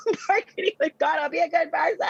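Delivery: high, squeaky laughing voice. Real, not acted. Woman laughing at her own bargaining with God, laughing high. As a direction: laugh through the words as pitch climbs.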